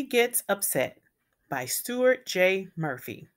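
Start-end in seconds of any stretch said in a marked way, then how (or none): none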